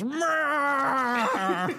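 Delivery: high, squealing cat-like meow; mock Gerard Butler meow. Retelling a movie scene, a high squealing meow. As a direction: yelp it out like a startled actor. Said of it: "Gerard Butler flails his head back and goes".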